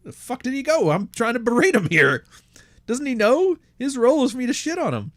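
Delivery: high-pitched, exasperated mock complaint; high mock rant at a vanished target; mock wife yelling. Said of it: "He's yelling mid-sentence".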